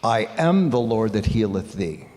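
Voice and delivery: "am" leaned on with a falling line; stress on AM. Shown as a demonstration. Pastor demonstrating sung emphasis, stress on "am". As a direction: hit the word "am" in "I am the Lord". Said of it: "emphasize different words every single time".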